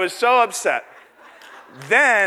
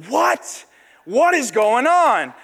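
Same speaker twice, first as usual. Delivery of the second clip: high-pitched, drawn-out disbelief; astonished; 6 semitones higher, 3 dB louder, much slower than usual. Retold or imitated. Young man hearing she wants a private room, astonished. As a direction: replay the shock, high then slow.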